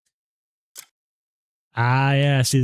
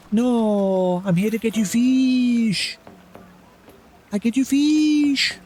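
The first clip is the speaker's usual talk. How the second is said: put-on much higher, slow baby voice to a pet; pet talk; 10 semitones higher, slower than usual. Gamer coaxing a skittish game cat, high slow pet talk. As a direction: coo at the cat so it stays.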